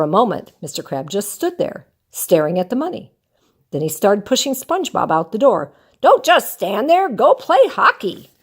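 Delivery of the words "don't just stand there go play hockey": urging. Acted. Krabs pushing SpongeBob out.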